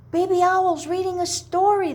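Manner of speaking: high, quick and excited; excited cry. Acted. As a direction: cry it out with glee.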